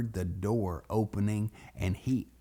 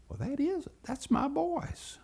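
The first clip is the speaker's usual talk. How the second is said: high-pitched, hushed realization; dawning realization; 4 dB quieter than usual. Retold or imitated. Daddy recognizes his boys' cries, dawning surprise. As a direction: let it dawn on you, high.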